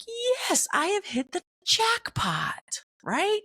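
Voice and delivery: high squeal of delight; thrilled. Retold or imitated. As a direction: celebrate like you won the jackpot. Thrilled about matching tea.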